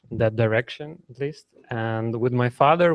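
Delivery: normal talking voice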